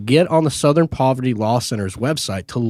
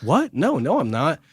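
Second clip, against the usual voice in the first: higher-pitched, retold mortified protest; mortified denial; 4 semitones higher than usual. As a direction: imitate a mortified guy denying an accusation. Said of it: "He is mortified".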